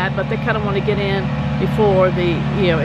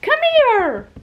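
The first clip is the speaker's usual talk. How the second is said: very high cooing pet voice; 14 semitones higher, much slower than usual. Real, not acted. Owner coaxing her dog to walk, very high cooing. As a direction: sweet-talk the dog in a tiny high voice.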